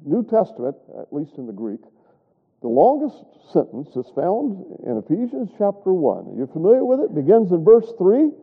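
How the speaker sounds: ordinary talk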